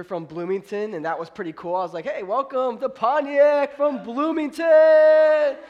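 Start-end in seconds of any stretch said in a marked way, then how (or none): 2.0-5.6 loud, high, drawn-out mock announcer greeting; hyped welcome shout. Pastor greeting out-of-town visitor, hammed-up welcome shout. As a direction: bellow an over-the-top welcome, stretching it out.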